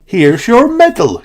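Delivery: high, bright presenting voice; Emily Elephant. A narrator's reading. Emily giving Zaza her medal, bright. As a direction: hand over the prize proudly. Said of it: "she added".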